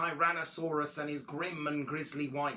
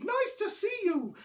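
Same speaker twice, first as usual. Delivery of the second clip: high duckbill greeting; high honking dinosaur; hooted; 4 dB louder than usual. A narrator's reading. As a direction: hoot a welcome way up high. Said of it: "they hooted".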